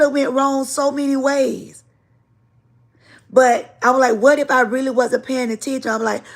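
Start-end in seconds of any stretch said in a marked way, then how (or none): none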